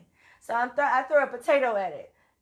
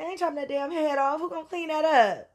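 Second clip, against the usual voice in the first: higher and louder, outraged no way; indignant refusal; 7 semitones higher, 3 dB louder than usual. Retold or imitated. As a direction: throw the idea back as ridiculous. Refusing cousin's advice to behead snake.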